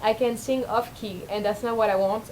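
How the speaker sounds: ordinary delivery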